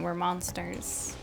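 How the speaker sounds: usual speaking voice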